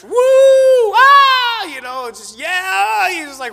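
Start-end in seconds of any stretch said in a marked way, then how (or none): 0.0-1.6 high loud whoop; a churchgoer overcome, shouting woo, ah; worship yell. Retold or imitated. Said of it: "just starts yelling". 2.4-3.1 high shouted yeah; a worshipper shouting yeah in church. Retold or imitated. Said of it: "starts yelling".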